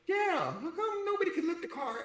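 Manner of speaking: very high, squeaky-pitched retelling; mock retort. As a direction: jump far up in pitch to question it. His mock retort in the story.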